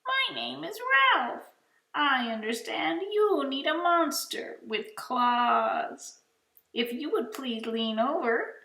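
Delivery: very high, refined monster voice; high silky voice. Acted. Monster Ralph introducing himself in a high silky voice. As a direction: sound prim and well-mannered, up high. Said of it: "in a high, silky voice".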